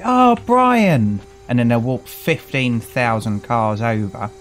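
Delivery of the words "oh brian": high, loud sing-song hail across a car park; hammed-up call to a mate across the tip; mock greeting call. Tip visitor greeting a friend, high, loud mock hail. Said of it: "then they'll go".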